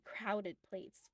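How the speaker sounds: usual speaking voice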